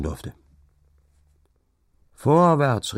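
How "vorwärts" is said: high, loud "Vorwärts"; calling out. A narrator's reading. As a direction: shout the order to go on. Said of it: "riefen die Geestleute".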